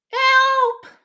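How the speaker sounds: high, loud cry of help; shout for help. Retold or imitated. Coach acting a drowning newbie, shouting help. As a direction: call out like someone drowning. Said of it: "You will shout".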